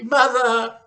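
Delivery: high, loud panicked orders; frantic daughter shrieking at mother. Acted. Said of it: "shrieked Missus Cranky".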